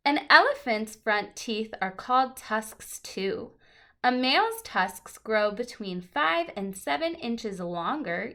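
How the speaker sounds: lively picture-book read-aloud; reading to children. Acted. Teacher reading a book to the class, lively and clear.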